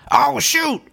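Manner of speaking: high, startled shriek of fright; a mock scared squeal; startled yelp. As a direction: yelp it like a sudden jump scare. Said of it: "she'd get scared and just be like".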